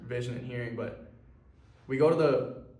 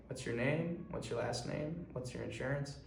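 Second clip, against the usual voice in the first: softly imitated unhurried desk clerk; hint of an uncomforting clerk, much softer; Monsters, Inc. librarian; 8 dB quieter than usual. Front desk guy questions an urgent patient, unhurried and uncomforting. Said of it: "like the librarian from Monsters, Inc. He's moving slow".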